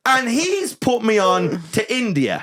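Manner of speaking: high, slow, disbelieving; high slow incredulous drawl. Real, not acted. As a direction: stretch it out in disbelief. Incredulous retelling.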